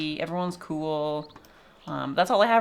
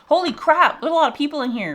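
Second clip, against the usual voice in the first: higher, louder astonished outburst; amazed exclamation; 9 semitones higher, 6 dB louder, faster than usual. Real, not acted. Streamer sees crowds at the babies, loud amazed outburst. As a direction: blurt it out in amazement.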